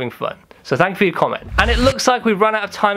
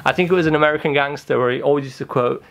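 ordinary delivery

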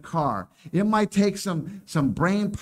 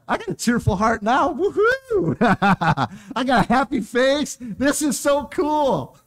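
higher, louder hammed-up joy with a whooping woohoo; mock cheerful whoop; 6 semitones higher, 4 dB louder than usual. Preacher mocking a congregant's new cheer, hammed-up glee. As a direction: ham up the happy convert, squealing with glee. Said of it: "now some of you go".

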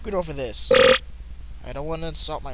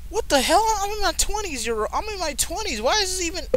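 high-pitched incredulous protest; indignant disbelief; 12 semitones higher than usual. Real, not acted. Player objecting that he's in his twenties, high indignant outburst. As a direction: voice jumping way up in disbelief. Protests game age mismatch.

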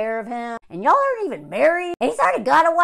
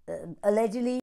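ordinary talk, nothing marked

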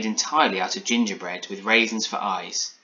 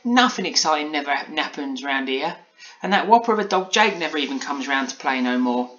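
put-on higher-pitched childish grumble; Polly complaining; 6 semitones higher, 4 dB louder than usual. A narrator's reading. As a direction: grumble like a bored little girl. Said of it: "she complained to herself".

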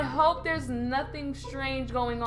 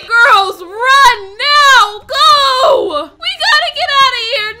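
high, loud frantic yelling; urgent shouting; 7 semitones higher, 13 dB louder, slower than usual. Acted. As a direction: shout at the girls to run. Urging friends to flee.

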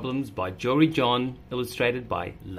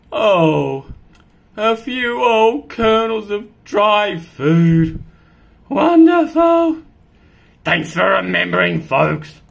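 loud, slow, sarcastic cat complaint; a grumpy cat demanding its food; indignant cat; 7 semitones higher, 11 dB louder than usual. Acted.

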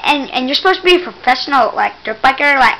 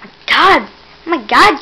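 usual speaking voice

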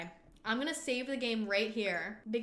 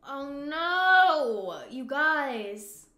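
dismayed cry; 3 semitones higher, 8 dB louder, much slower than usual. Real, not acted. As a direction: cry out oh no to the fans. Reacting to a disaster in game.

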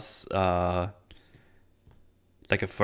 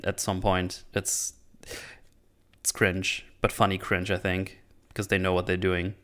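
usual speaking voice

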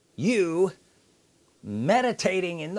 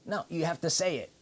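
ordinary talk, nothing marked

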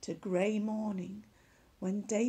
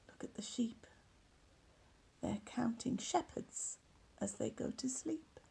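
whispered aside; 7 dB quieter than usual. Real, not acted. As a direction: lean in and whisper the joke. Aside to the listening child.

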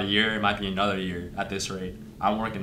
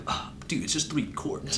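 hushed, higher mock reply; teasing, half-whispered self-imitation; mimicking himself; 6 semitones higher, 5 dB quieter than usual. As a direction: play it off lightly under your breath. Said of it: "and I'm like".

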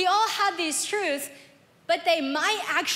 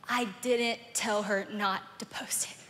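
much softer, slow, low confession; quiet confession; 5 semitones lower, 7 dB quieter, slower than usual. Retold or imitated. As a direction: confess it softly and slowly. Husband's trembling confession.

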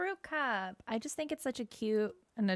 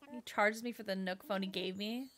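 ordinary talk

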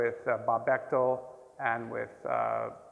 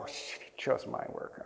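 soft whispered aside; whispered dismay; 3 semitones lower, 9 dB quieter than usual. Retold or imitated. Student whispers to himself as his work is chosen, dismayed. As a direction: hiss it to yourself in alarm.

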